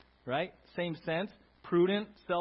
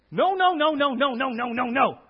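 loud stern string of no; scolding no after no; repeated no's; 5 semitones higher, 9 dB louder than usual. Pretend. Preacher mocks a church of constant no, loud scolding.

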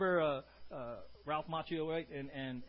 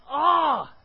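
loud high shout of discovery; a hammed-up yell of sudden insight; realization shout; 11 semitones higher, 18 dB louder than usual. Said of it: "He's like".

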